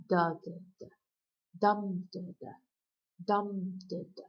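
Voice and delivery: loud first beat, then two weak "da"s; strong-weak-weak pulse, "DUM da da"; dactylic stress. Shown as a demonstration. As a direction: punch "dum", let "da, da" drop away. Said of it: "the first syllable is stressed".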